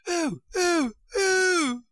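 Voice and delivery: high, loud squealed ohs; queen's screams. A narrator's reading. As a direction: shriek each OH like a storybook queen. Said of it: "began screaming so loud".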